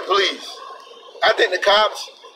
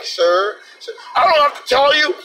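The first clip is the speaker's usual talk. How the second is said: high, mock-indignant protest; mock entitled gunman; 6 semitones higher than usual. Entitled man lectures police about his rights, high and indignant. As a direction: whine it like an entitled gun carrier. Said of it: "the police like".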